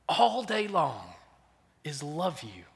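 mother's tender words. Retold or imitated. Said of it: "she says".

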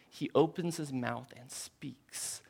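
whisper. Real, not acted. Hushed before God's words.

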